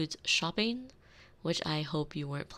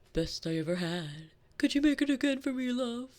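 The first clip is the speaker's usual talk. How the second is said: higher, louder mock-spouse voice; mimicking a spouse; 8 semitones higher, 4 dB louder than usual. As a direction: put on your partner's voice, pitched up and quick. Mimics spouse's praise and request.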